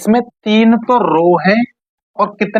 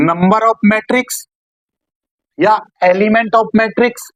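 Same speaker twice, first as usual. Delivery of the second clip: slow dictation; 2 semitones higher, much slower than usual. Shown as a demonstration. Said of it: "teacher dictates the term".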